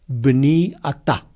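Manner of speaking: slow, careful Hebrew "bni atá", each syllable spelled out; Hebrew pronunciation. Shown as a demonstration. Teacher giving radio listeners the Hebrew words, slow and careful. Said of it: "así se dice en hebreo".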